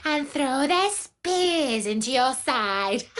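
cackling hyena. Acted. The hyena taunts the trapped lioness, gloating and high. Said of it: "He cackled with his ugly voice and taunted".

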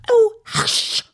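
high, urgent, alarmed shushing; frightened whisper. A narrator's reading. Rabbit hushes laughing Alice, frightened. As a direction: gasp "oh" high, then hiss the hush. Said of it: "whispered in a frightened tone".

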